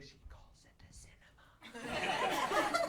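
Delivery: hushed, whispered aside; awed whisper. Retold or imitated. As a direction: whisper it, drawing out the last word. Acted aside, smitten by her word.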